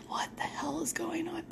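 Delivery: hushed, unvoiced whisper; whispered exasperation. Tired camper complaining to herself about the noisy boys, exasperated whisper. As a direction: whisper it as an annoyed question. Woken at night by whisper yelling.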